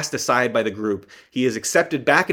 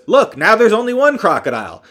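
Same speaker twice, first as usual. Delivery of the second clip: higher, louder, cocky announcement; triumphant shout; 9 semitones higher, 7 dB louder than usual. Retold or imitated. Brendan Gleeson after blowing a crocodile's head off, loud and higher. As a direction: call it out proud after the kill. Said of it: "and he goes".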